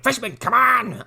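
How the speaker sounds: high, drawn-out urging cry; exasperated mock call. Stern urging scared kid over bridge, drawn-out mock yell. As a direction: call out slowly, pitched right up.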